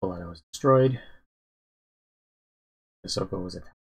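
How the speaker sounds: ordinary delivery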